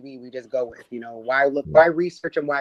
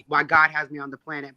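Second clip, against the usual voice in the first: normal talking voice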